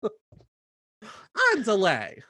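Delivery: drawn-out high, slow put-on delivery; hammed-up slow sitcom-star voice; Valerie Cherish voice. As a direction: stretch every word like a diva. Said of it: "imagining Valerie Cherish".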